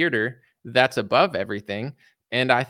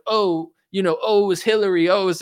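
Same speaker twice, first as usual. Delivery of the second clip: mocking voice; 6 semitones higher than usual. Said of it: "it's just like".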